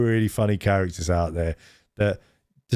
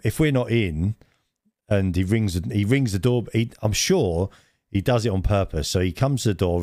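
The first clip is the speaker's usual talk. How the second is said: normal talking voice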